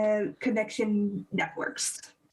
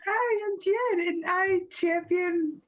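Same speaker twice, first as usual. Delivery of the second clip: crying and laughing; 6 semitones higher than usual. Real, not acted. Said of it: "I'm crying and laughing so hard".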